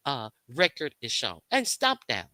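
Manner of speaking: ordinary talk, nothing marked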